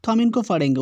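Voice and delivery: usual speaking voice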